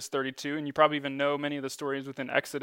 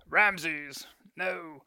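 mock British movie Pharaoh; British-accented film villain impression, drawn out; 2 semitones higher than usual. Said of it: "Pharaoh in that movie has a British accent".

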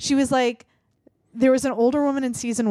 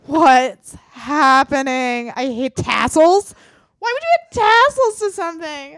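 higher, louder mock outrage; exasperated rant; 6 semitones higher, 8 dB louder, slower than usual. Watching designers add fringe, she rants in louder, higher mock disgust. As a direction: whine it out like the tassels personally offend you.

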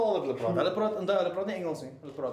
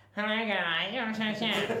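put-on strained American accent; 6 semitones higher, much slower than usual. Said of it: "dan praat jy Amerikaans".

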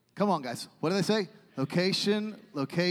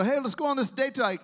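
mock-eager invitation, pitched up; upbeat suggestion; 7 semitones higher, 4 dB louder than usual. Hiker pitches a day hike to the group, upbeat. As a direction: brighten up, higher and a little louder.

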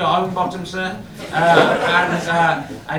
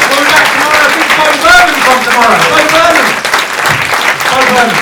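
loud, high, rousing send-off; show-closing shout; 7 semitones higher, 13 dB louder than usual. Real, not acted. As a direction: call it out to the whole room. Shouting plugs to close the show.

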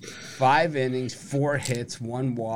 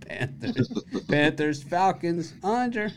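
climbing higher to a high under; mock inflection; 8 semitones higher, 3 dB louder, slower than usual. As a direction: tease by copying the rise. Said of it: "I caught the inflection there".

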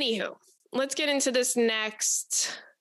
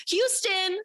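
mock mission control call; 8 semitones higher than usual. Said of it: "we got a problem".

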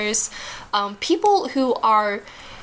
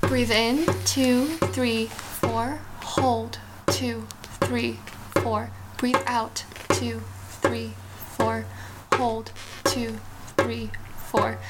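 slow breath counting; 3 dB quieter, slower than usual. Shown as a demonstration. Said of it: "I would like our listeners to try this".

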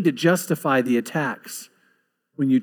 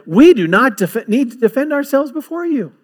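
high, assured declaration; confident; 8 semitones higher, 4 dB louder than usual. Acted. Tested men answering the king, confident. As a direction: state it firmly, pitched up, without any doubt. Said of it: "There's confidence".

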